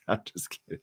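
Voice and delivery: breathy giggle through the words; teasing giggle after a cheeky joke; laughing it off. Real, not acted. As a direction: laugh the line away, half whispered.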